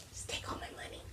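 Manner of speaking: awestruck whisper, barely voiced; hushed whisper. Overwhelmed shopper to the fabric store, whispering in surrender. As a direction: whisper it as if helplessly giving in.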